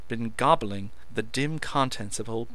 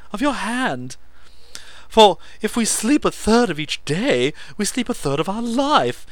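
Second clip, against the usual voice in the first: hysterical excitement; 10 semitones higher, 9 dB louder than usual. A narrator's reading. Meadows hysterically grateful.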